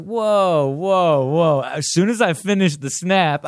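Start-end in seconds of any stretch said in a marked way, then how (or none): 0.0-1.6 startled whoa. Retold or imitated. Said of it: "And then I was like".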